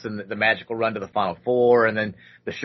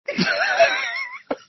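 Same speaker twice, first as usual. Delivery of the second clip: burst of laughter. Retold or imitated. Crowd laughing at him.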